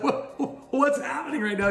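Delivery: high-pitched, half-laughing disbelief; retold bewilderment. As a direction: act out a startled dad, voice jumping up. Father retells his bewildered reaction.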